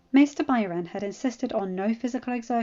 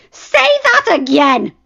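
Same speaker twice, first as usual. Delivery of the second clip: furious challenge; 10 semitones higher, 15 dB louder, slower than usual. Acted. Dany leaps up furious.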